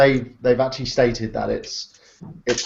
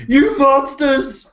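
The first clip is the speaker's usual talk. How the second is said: high, slow wailing horror; outraged horse; 13 semitones higher, 4 dB louder, much slower than usual. Retold or imitated. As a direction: cry it out like a wronged horse.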